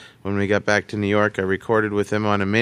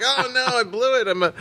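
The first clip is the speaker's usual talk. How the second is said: higher, panicked self-scolding; self-blame; 8 semitones higher than usual. Retold or imitated. Young interviewer after being yelled at, higher panic. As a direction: beat yourself up in a mock whine. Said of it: "I was like".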